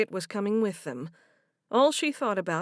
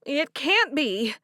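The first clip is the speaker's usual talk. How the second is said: slow, broken denial; can't take it in; stunned disbelief; 6 semitones higher, 5 dB louder, slower than usual. A narrator's reading. Hearing of a death.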